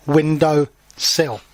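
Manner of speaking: windowsill said very slowly, syllable by syllable: win-dow-sill; teacher stretching out windowsill; slow, clear naming. Shown as a demonstration. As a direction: spell out windowsill slowly for the children. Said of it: "We call this".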